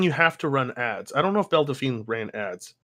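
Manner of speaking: usual speaking voice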